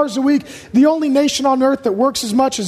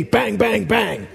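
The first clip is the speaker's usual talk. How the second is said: imitated gunshots; 5 semitones lower, 4 dB quieter, slower than usual. Mimics gunshots at the successful.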